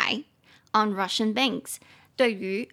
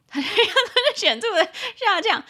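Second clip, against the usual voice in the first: laughing lament; 11 semitones higher, 6 dB louder than usual. Real, not acted. Joking about salaries dropping.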